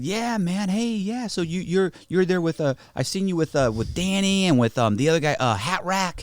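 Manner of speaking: high-pitched chatty stranger impression; mock admirer searching for names; eager fan. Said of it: "He said".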